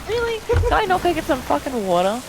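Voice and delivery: high, exasperated mock complaint; indignant high-pitched retelling; exasperated quote. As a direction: squeak it out in disbelief. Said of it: "I'm like".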